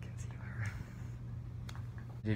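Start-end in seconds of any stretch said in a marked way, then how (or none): 0.0-0.7 hushed whispered answer. Real, not acted. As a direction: whisper the word very softly.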